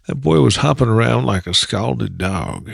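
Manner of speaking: slow Appalachian accent with the tongue pulled far back. Shown as a demonstration. Actor demoing his Bat Boy sheriff line, slow Appalachian accent. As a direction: West Virginia Appalachian twang, stretched out slowly. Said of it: "At one point I get to say".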